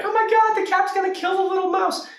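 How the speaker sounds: very high, fast squeal; put-on high-pitched fretting; mock horror. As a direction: squeal it like a horrified onlooker. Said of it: "Now you might be like".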